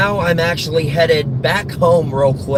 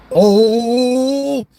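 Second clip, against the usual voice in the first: long, high substitute for a swear; Dan Castellaneta; 7 semitones higher, 3 dB louder than usual. Retold or imitated. Actor dodging a forbidden "damn", long annoyed grunt. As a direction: start hard, then stretch the vowel upward. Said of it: "he used to just go".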